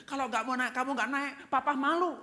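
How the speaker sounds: high, loud demonstration of nagging; mock parental scolding. Shown as a demonstration. Parent shaming child over grades, high loud mock scolding. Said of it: "Jangan berkata begini".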